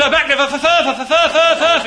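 high, loud stuttering strain; frantic stammer. Acted. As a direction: fight the stammer hard, pitch climbing. Stammer third time, highest.

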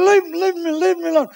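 put-on high, loud drunken insistence; shouting like an impatient drunk. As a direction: wave off help with a boozy holler. Drunk orders helper off fallen mate.

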